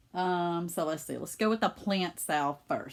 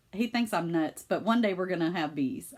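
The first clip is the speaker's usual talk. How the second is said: ordinary delivery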